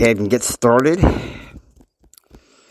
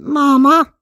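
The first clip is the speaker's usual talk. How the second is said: much higher, louder little call; high-pitched; 5 dB louder than usual. Retold or imitated. J R calling to his mother, high-pitched. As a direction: squeak it out high like a boy. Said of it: "he's high-pitched up again".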